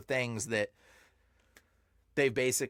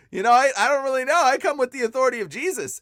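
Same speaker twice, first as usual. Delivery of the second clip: fast, much higher and louder, half laughing; excited reply; 9 semitones higher, 9 dB louder, faster than usual. Retold or imitated. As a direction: rush it out, high-pitched and loud. His own reply to the demon.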